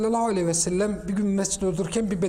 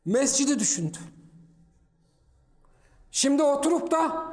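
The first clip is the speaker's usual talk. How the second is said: preaching; 6 semitones higher than usual. Acted. Friday sermon at full force.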